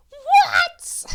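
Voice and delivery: high, loud eager yell. A narrator's reading. Kitty yelling to hear the secret, loud and high. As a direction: shout it out impatiently. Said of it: "yells Kitty".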